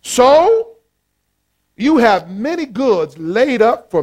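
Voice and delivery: drawn-out self-satisfied address to his soul; lightly voiced smug rich man; rich man talking to himself. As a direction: savor every word like a rich fool. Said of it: "He was talking to himself".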